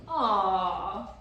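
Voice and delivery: loud fake sympathetic aww; mock sad aww. A narrator's reading. Gaboons pretending to be sad, mock aww. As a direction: coo it with fake pity. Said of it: "pretended to be sad".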